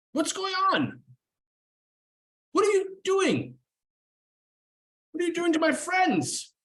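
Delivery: very high, alarmed elf voice; high-voiced elf. Elf surfacing to the party, high and alarmed. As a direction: squeak it out like a startled elf. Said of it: "and he says".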